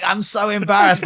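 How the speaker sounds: high, loud mock Indian mum; Indian mum accent. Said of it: "My mum's like".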